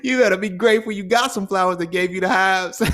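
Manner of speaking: high, loud, laughing scold; mock indignant. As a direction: scold them while laughing. Mocking the gift giver.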